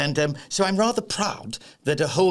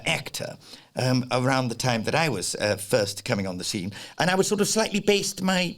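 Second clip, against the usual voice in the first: ordinary delivery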